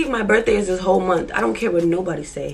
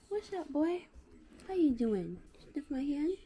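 soft doggy talk; 4 semitones higher, 19 dB quieter than usual. Real, not acted. Cooing softly to the dog at the salon. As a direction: coo gently at the dog.

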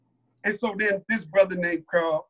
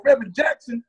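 normal talking voice

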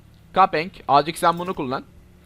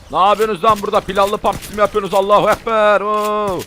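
loud, high shouts at the horde; shouting over the fight; startled yelling; 4 semitones higher, 5 dB louder than usual. Real, not acted. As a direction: yell in mock outrage at the zombies. Zombies appear, yelling at them.

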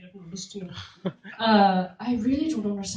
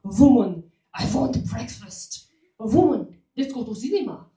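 mock caveman boyfriend; 4 dB louder, slower than usual. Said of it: "they think our relationship is like this".

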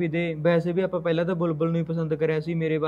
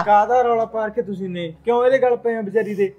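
higher, louder, cutting into the quarrel; angry interruption; 4 semitones higher, 4 dB louder than usual. Acted. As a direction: demand what the fuss is about. Son intervenes in family quarrel. (ਗੁੱਸੇ ਨਾਲ ਉੱਚੀ ਆਵਾਜ਼ ਵਿੱਚ ਦਖ਼ਲ)

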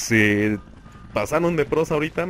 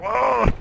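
startled cry; 11 semitones higher, 3 dB louder than usual. Real, not acted. Just killed in game.

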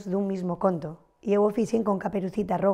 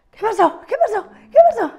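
rising higher and louder with each ask; eager kids pressing the question, growing more insistent; curious children; 13 semitones higher, 7 dB louder than usual. Retold or imitated. Children pressing the teacher after her gasp, getting higher and louder.

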